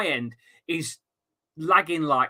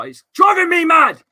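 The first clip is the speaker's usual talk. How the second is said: loud, high exasperated yell; frustrated shout; 12 semitones higher, 11 dB louder than usual. Real, not acted. Streamer ranting to chat about his broadband, yelling in frustration. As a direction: let it burst out, fed up with the internet. Internet keeps cutting out, exasperated.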